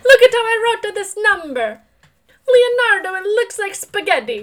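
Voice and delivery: high, loud hammy Italian; Italian accent. Pretend. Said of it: "my bad Italian accent".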